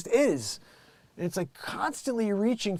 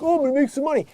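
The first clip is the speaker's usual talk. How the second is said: mock money-grubber; 8 semitones higher, 7 dB louder, much faster than usual. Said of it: "because I'm like".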